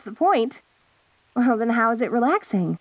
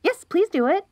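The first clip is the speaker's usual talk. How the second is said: normal talking voice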